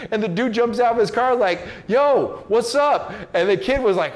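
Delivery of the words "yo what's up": higher and louder retold challenge; confronting challenge. Dude jumping out of his car at the kid, loud and challenging. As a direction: square up and call him out.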